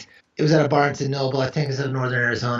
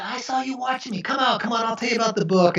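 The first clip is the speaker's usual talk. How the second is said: playful coaxing; 9 semitones higher than usual. Retold or imitated. Coaxing hiding shoppers down aisles.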